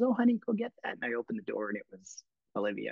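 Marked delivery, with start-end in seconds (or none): 0.0-0.9 high, put-on starlet voice; Dee Dee's voice. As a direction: purr it like a glamorous actress. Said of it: "And she goes".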